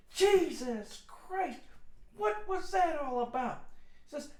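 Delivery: high, loud imitation of a shocked husband; startled outburst. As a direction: yell as if badly startled, hammed up. Startled father reacts to the prank.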